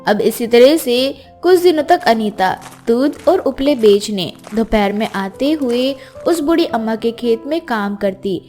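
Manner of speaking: ordinary delivery, nothing marked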